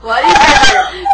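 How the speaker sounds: very high, loud, drawn-out yell; piercing playful shriek; mock scream. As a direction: scream the words out slowly. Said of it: "We screamed".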